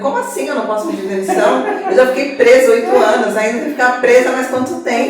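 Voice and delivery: loud, fast disbelieving protest, laughing through it; indignant outburst. Retold or imitated. Retelling her outburst at the lawyer, loud incredulous laughing protest. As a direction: throw the question back, outraged but half laughing.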